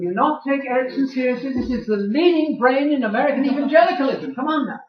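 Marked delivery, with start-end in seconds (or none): none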